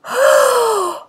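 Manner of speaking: a huge real yawn, high and drawn out; big yawn. Real, not acted. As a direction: let a real yawn take over. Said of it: "I'm gonna yawn".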